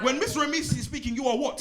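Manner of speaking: high, sing-song call to the class; teacher drilling pupils on silence. Acted. Repeated drill, laughing.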